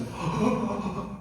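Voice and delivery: high, drawn-out sobbing gasp; acted high wail on a gasp; imitated crying gasp. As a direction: mimic a man breaking down in sobs.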